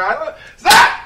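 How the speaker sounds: a high, loud shouted slap effect; mock slap. Host acts out being slapped with a loud shouted slap. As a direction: blurt the slap loudly. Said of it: "mimics slap".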